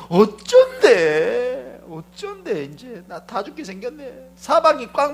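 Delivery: a slow, drawn-out wail of fear; trembling fearful cry. Acted. Gehazi trembling before the enemy army, a drawn-out fearful wail. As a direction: moan like a panicked servant. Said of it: "개아씨처럼 떨지 말아요".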